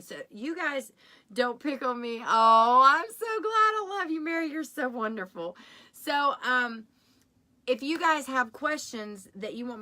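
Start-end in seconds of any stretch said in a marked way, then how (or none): 2.3-5.6 high, gushing thanks to a viewer; real delight. Real, not acted. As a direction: burst out with joy at the comment. Delighted reaction to viewer comment.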